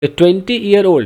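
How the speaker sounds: "year" given weight, slow read; careful slow read stressing "year". Shown as a demonstration. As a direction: lean on "year" to show the singular. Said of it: "बहुत गौर से देखो".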